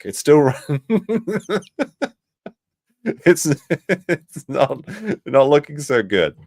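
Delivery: high, loud laughter breaking up the words; real giggles through the line; laughing while talking. Real, not acted. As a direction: crack up mid-sentence and struggle to finish. Laughs at the river's state.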